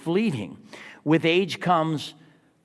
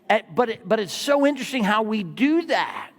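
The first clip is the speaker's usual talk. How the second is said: ordinary talk, nothing marked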